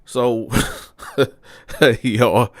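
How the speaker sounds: real laughter breaking into the words; laughing. Real, not acted. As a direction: crack up while addressing the listeners. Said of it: "I'm laughing because".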